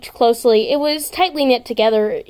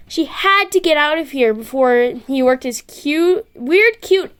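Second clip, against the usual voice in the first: ordinary delivery, nothing marked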